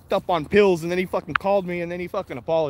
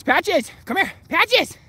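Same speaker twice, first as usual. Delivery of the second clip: high, loud summons; calling a dog; 11 semitones higher, 5 dB louder, much slower than usual. Real, not acted. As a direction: call the dog over sharply.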